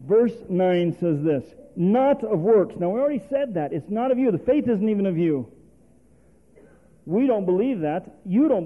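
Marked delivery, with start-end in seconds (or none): none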